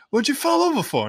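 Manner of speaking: high cheeky voice; Stan asking. Retold or imitated. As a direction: tease him about falling. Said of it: "Stan's like".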